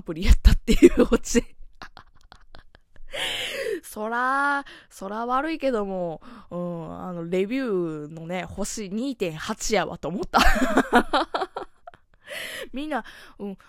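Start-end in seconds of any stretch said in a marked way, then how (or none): 3.0-3.8 breathy unvoiced giggle; silent laugh. Real, not acted. Host amused by his own punchline, breathy laugh. 10.5-11.7 high burst of laughter. Real, not acted. Host mocking the app's review score, high laugh.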